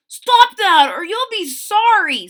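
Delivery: high, loud stern warning; protective warning. A narrator's reading. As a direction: shout it to protect your sister. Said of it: "said Herbie to the birds".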